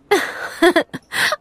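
helles, hohes Mädchenlachen; Lachen. A narrator's reading. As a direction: lach fröhlich los. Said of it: "Mia lachte". (Mia lacht über die Frage: hell und hoch)

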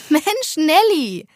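high, loud, drawn-out teasing cry; amused exclamation. A narrator's reading. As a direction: stretch it out with amusement. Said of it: "lachte Kata". (belustigt und langgezogen ausrufen)

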